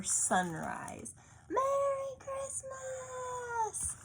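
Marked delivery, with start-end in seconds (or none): 1.5-4.0 high, drawn-out joyful greeting; excited shout. A narrator's reading. Luca waking his family before sunrise, high and drawn-out with joy. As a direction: sing it out slowly with delight. Excited boy wakes everyone.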